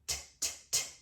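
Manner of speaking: T said as a voiceless puff, soft; T, T, T as whispered bursts of air; unvoiced T. Shown as a demonstration. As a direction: say just the T consonant, no voice. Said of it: "the unvoiced twin".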